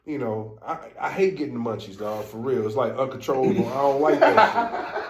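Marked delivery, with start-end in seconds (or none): 3.7-5.1 talking through laughter; slow, high and breaking into laughs; laughing. Real, not acted. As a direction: crack up while complaining. Cracking up over munchies complaint.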